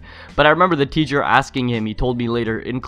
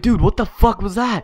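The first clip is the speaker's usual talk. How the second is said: shocked friend; 9 semitones higher than usual. Retold or imitated. Friend reacts after being peed on.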